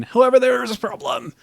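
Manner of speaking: high, loud hammed-up narrator; dramatic announcement. Hammed-up reading of game text.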